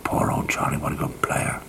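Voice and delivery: very soft, low lament; hushed regret. Retold or imitated. Old cricketer on hearing of Charlie's death, hushed regret. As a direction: murmur it low, almost whispered. Mourning a dead teammate on phone.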